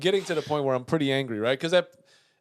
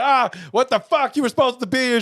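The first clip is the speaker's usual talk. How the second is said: screaming; 9 semitones higher, 4 dB louder than usual. Retold or imitated. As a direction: drag out the angry complaint. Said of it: "I'm just screaming".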